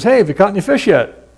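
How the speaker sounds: lightly voiced friendly stranger. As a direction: hail the fishermen lightly. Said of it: "some guy from church goes".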